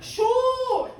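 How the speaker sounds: very high, drawn-out mock yell; high heckling cry; fan going off. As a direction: holler it at the players from the stands. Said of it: "I hear Bobby Lee going off".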